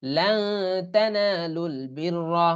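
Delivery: very slow, drawn-out recital; Quran recitation. Shown as a demonstration. Teacher shows how the verse is recited, slowly. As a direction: stretch every syllable like a reciter. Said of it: "তাহলে উচ্চারণ কেমন হবে?".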